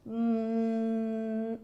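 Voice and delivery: soft hummed hum to feel the vocal cords; voiced hum demo. Shown as a demonstration. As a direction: hum so the throat buzzes. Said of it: "quando você fala, hum".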